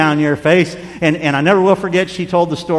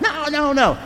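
high mock yell of no, softer than it looks; retold scream; 11 semitones higher, 3 dB quieter than usual. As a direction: squeal out a child's panicked no. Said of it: "and I screamed out".

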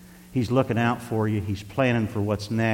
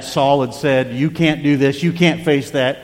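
loud, raised pulpit voice; louder and higher, pressing each phrase; emphatic preaching; 4 semitones higher, 7 dB louder than usual. Acted. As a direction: preach the story out forcefully. Preaches at full force.

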